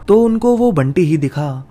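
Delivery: usual speaking voice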